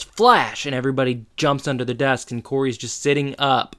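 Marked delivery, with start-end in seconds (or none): 0.0-0.6 a higher, louder mock alarm call; yell. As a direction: bark the warning at the class. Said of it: "So he yells".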